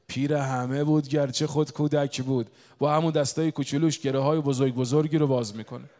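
loud, emphatic proclamation; preacher at full force; forceful preaching. Real, not acted. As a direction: proclaim it with a surge of volume. Preaches with raised voice.

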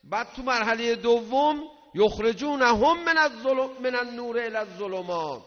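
high, loud preacher's cry; preaching at full force. Acted. Preacher crying out a verse to the congregation, loud and high. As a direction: drive the verse home to the crowd.

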